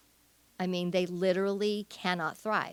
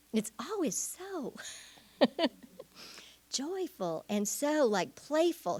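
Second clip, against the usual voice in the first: light, playful imitation pitched higher; Karen Purvis voice; 7 semitones higher, 3 dB quieter than usual. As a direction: lightly mimic a gentle child therapist. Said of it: "she has a way of talking".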